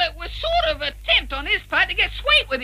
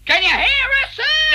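raised, higher call to someone hard of hearing; pitched up to get through to him; 7 semitones higher, 4 dB louder than usual. Acted. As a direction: call up loudly to check he can hear. Calling to teacher feared deaf.

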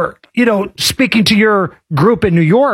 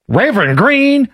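lightly twanged imitation of a politician; mock Southern accent; 3 semitones higher than usual. Host mimicking a church speech, mock Southern accent. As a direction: parrot it with a put-on Southern lilt. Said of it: "Hillary doesn't sound like that".

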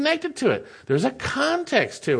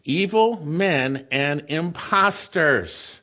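1 slow weighted phrase; much slower than usual. Shown as a demonstration. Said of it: "What's an imposter?".

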